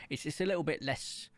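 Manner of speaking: normal talking voice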